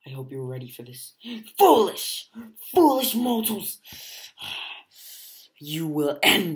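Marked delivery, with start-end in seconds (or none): 1.6-3.8 high, loud, strange-voiced villain cry; villain boom. Villain shouts at the fighters, high, loud and strange. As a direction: thunder at the mortals.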